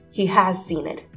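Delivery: usual speaking voice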